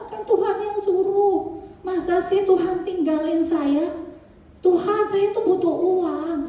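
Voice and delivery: high pleading cry to God; pleading prayer, voice lifted high; desperate retold prayer. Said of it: "saya teriak".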